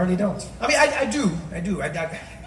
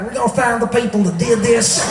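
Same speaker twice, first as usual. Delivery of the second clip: talking out of the side of the mouth; George Bush; 4 dB louder than usual. Acted. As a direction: push the words out sideways. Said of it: "speaking out of the side of his mouth".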